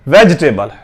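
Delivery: वेजिटेबल with no i, "vej-table"; correct pronunciation. Shown as a demonstration. Teacher builds the word for learners, correct pronunciation. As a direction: drop the middle vowel of वेजिटेबल. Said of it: "वेजिटेबल पढ़ते हैं".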